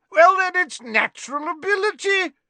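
Slughorn booming, high and loud; hearty shout. Acted. Tipsy professor shouting down Snape, high and loud. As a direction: bellow it jovially. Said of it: "shouted Slughorn".

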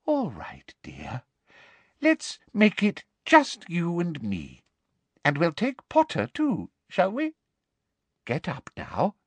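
whispered, falsely sweet; Umbridge's put-on motherly coo; mock motherly. A narrator's reading. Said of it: "in what she clearly thought was a motherly voice".

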